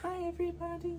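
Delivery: tiny high, soft greeting; pet-talk voice. Real, not acted. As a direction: squeak it softly, as if for a kitty. Voicing greeting for the cat.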